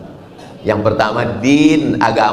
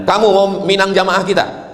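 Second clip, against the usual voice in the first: stern examiner; 7 semitones higher than usual. Acted. Ustadz interrogates suitor, acted.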